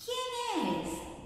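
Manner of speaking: high, thin little granny voice; grandma's voice. Acted. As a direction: call out like a frail old granny. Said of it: "dijo la abuelita".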